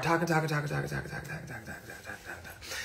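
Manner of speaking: rattled-off repetition fading to near silence; endless chatter. Retold or imitated. Mimicking someone who can't stop talking, nonstop chatter fading out.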